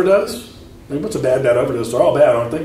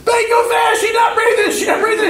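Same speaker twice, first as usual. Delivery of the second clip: high desperate yell at the driver; panicked shout. Retold or imitated. Boy yelling at his sister to drive faster, panicked high shout. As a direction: yell it like she's dying in the back seat.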